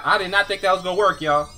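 loud, high burst of surprise; excited. Real, not acted. Gamer thrilled his PC build actually worked, loud and excited. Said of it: "Oh, snap".

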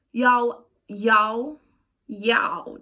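put-on playful try at a Texas accent. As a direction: put on a rough Texas twang. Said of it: "That sounds Texas, don't it?".

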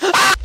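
a very high, loud shriek of fright; frightened scream. Real, not acted. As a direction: shriek as something attacks. Monster attack scream.

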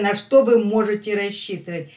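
normal talking voice

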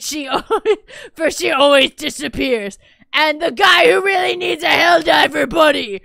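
loud, slow heroic battle cry; epic proclamation voice. Acted. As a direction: announce it like a war chief. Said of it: "I can use a voice".

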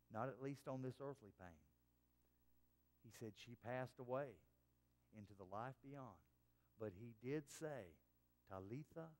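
low, hushed, reflective recital; solemn testimony. Retold or imitated. Preacher quoting a father's memory of his dying daughter, solemn and subdued. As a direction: recount it softly and gravely, letting pauses fall between phrases.

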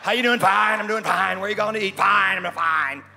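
loud, high mock insistence; hammed-up, over-loud "I'm fine" act; insisting fine. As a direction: fire back each answer too forcefully. Acted curt exchange insisting on fine.

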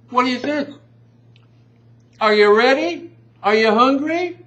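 high, loud cartoonish voice; goofy high voice. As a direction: tease the viewers in a silly squeaky voice. Teasing the audience in put-on voice.

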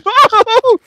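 very high, loud whooping shriek; real startled shriek breaking into laughter; panicked squealing laugh. Real, not acted. Feeder crowded by big animals, shrieking nervously.

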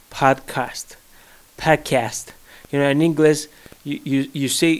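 normal talking voice

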